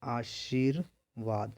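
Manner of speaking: word drawn out in separate parts, "आशीर्... वाद"; slow dictation. Shown as a demonstration. Teacher writing the correct spelling for students, slow dictation. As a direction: say "आशीर्वाद" very slowly, broken up as if writing it.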